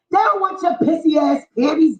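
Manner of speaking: usual speaking voice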